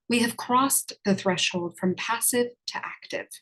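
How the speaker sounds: normal talking voice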